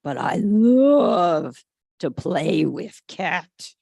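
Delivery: slow, loud, low eager voice; longing to play. A narrator's reading. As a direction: draw it out like a puppy who can't wait. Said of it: "George thinks".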